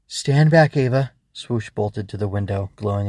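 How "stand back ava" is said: higher, louder protective command; urgent warning. Acted. Swoosh warns Ava as shadows break in, urgent. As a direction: warn her firmly to keep clear.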